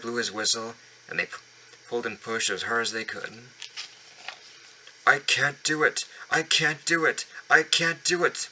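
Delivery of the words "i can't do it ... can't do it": rising higher and louder with strain; puffing strain. Acted. Gordon straining up the hill, puffing chant. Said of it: "puffed Gordon".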